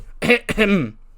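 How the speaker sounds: loud, high mock throat clearing. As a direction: clear the throat pointedly to get attention. We just hear from off screen.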